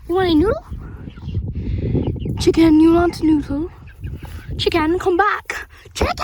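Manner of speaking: loud, high pleading call; calling chickens. Real, not acted. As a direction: call after the fleeing hen.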